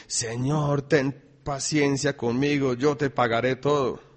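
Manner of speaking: loud, ringing Bible reading to the church; scripture reading. Acted. Preacher reading Mateo aloud, loud and ringing. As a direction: proclaim the verses to the congregation.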